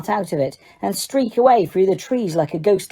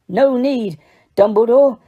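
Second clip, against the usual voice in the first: wheezy growl; 4 semitones higher than usual. A narrator's reading. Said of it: "said a wheezy growl".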